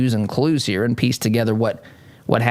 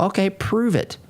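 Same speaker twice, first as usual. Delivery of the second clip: mocking taunt; 8 semitones higher than usual.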